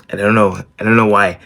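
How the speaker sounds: normal talking voice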